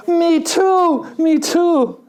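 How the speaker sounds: high, eager, breaking into a laugh; gleeful me too. Retold or imitated. Preacher telling a joke, gleefully agreeing as he laughs.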